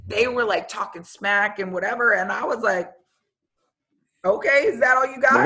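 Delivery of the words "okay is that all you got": louder, higher defiant taunt; defiant challenge. Retold or imitated. Answering the crowd's noise with a defiant taunt. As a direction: throw the challenge back.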